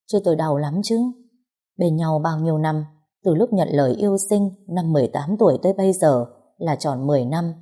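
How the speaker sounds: ordinary delivery, nothing marked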